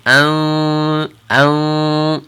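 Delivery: an held with tongue at the front; nasal an ready for the next letter; nasal an, tongue forward. Shown as a demonstration. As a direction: hum an with tongue near the teeth. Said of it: "it's a little different sound".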